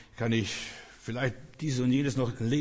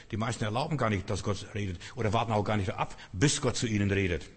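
ordinary delivery

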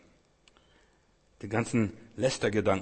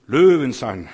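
a roared "Löwen sein," much louder; sudden burst; 13 dB louder, slower than usual. Acted. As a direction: blast out "Löwen" like a lion's roar. Preacher bursts out on lions.

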